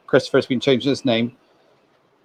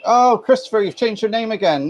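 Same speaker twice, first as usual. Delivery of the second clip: high exaggerated mock surprise; playfully shocked at the news; 10 semitones higher than usual. Mock shock at name change.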